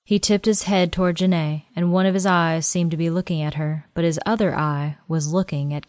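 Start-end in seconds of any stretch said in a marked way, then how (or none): none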